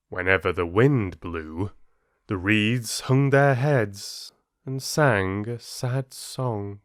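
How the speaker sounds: gentle, measured storyteller reading a fable; calm fable narration for listeners; story narration. A narrator's reading. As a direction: tell it slowly like a bedtime fable. Reads fable aloud.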